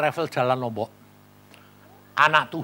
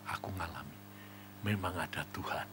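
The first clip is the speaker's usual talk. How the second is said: very soft and lower; hushed testimony; 9 semitones lower, 17 dB quieter than usual. Retold or imitated. Convert testifying that God is real, hushed. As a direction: say it in awe, barely audible.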